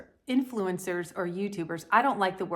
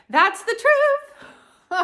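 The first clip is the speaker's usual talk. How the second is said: high squeaky laugh through the words; cracking up at her own joke; 14 semitones higher, 9 dB louder, much slower than usual. Real, not acted. Laughing after sassy comeback.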